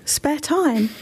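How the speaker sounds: high, incredulous echo breaking into laughter; mock disbelief. Real, not acted. Mayor mocks the question, laughing.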